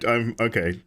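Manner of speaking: ordinary delivery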